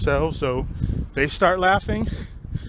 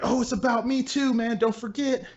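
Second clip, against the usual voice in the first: much higher whiny mock voice; mock needy whine; 8 semitones higher than usual. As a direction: squeak it out like a needy show-off. Mocking the guy begging attention.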